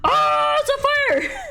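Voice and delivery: high, loud shrieked alarm; mock panic. Streamer imitating Gavin's panic, high shriek. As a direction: squeal it in cartoon terror. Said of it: "he's like".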